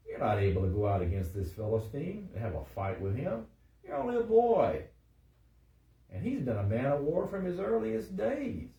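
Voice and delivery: emphatic, with high loud peaks; dramatic scripture reading. A narrator's reading. Saul doubts the young David, dramatic scripture reading. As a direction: declaim Saul's objection, surging up at the ends. Reads Saul's objection dramatically.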